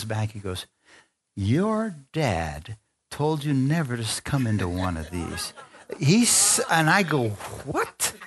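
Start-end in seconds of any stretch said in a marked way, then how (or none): 1.4-5.5 evangelist's pronouncement. Retold or imitated. Evangelist stepping back to address wife, solemn pronouncement. 7.6-8.1 high, soft squeak of surprise; stunned disbelief. Retold or imitated. Husband hearing stranger's revelation, stunned.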